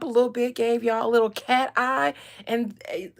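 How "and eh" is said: usual speaking voice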